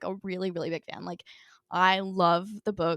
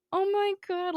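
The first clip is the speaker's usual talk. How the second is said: mouthed; 10 semitones higher, slower than usual. Retold or imitated. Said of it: "Like, she mouths it".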